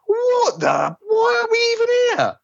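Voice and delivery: high hammed-up exasperation; Ben impression. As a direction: moan that it's all pointless. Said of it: "that character just going".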